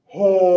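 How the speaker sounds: very loud, long sustained didgeridoo drone; booming vocal didgeridoo; didgeridoo imitation. Shown as a demonstration. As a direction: blast out one long held note like a didgeridoo. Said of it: "here's what a didgeridoo sounds like".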